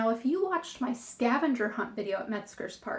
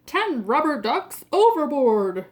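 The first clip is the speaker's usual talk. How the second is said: loud shout, hitting ten; captain shouting; 6 dB louder than usual. Acted. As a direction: call it louder as the captain. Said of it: "shouts the captain".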